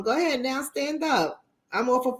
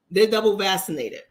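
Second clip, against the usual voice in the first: usual speaking voice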